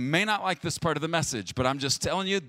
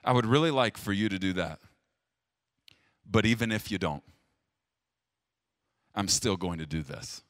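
committed spouse; 6 semitones lower than usual. Shown as a demonstration. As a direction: promise it gently, no matter what. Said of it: "A covenantal marriage talks like this".

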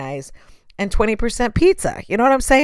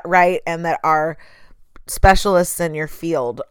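ordinary talk

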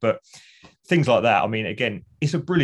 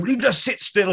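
much higher, exasperated wife; Margaret scolding; 11 semitones higher than usual. Retold or imitated. Wife scolding her restless husband, high and exasperated. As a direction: nag him to sit still, pitched way up.

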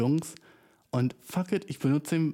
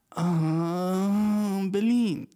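exasperated; 6 semitones higher, 3 dB louder, much slower than usual. Real, not acted. Exasperated sigh at Berlin.

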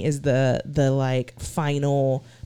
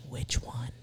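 eerie whisper; 6 semitones lower, 12 dB quieter than usual. Retold or imitated. Imitating a ghostly EVP voice.

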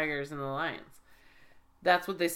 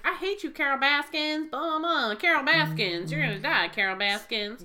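higher ranting Joe Exotic voice; 6 semitones higher than usual. Retold or imitated. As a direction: rant at Carol Baskins mockingly. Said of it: "he's like".

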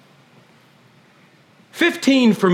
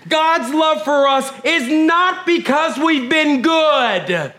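normal talking voice

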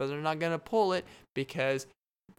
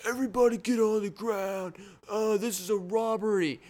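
high, loud robber barking orders; robber shouting; 9 semitones higher, 4 dB louder than usual. Acted. Robber orders the salad shop to the ground, high and loud. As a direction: yell it like a nervous stickup man.

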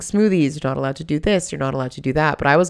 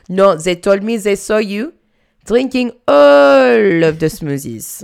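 higher, louder, slow drawn-out accusation; accusing boss; 4 semitones higher, 7 dB louder, slower than usual. Retold or imitated. Jerome accusing her, drawn out. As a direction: stretch out all of the smoothies.

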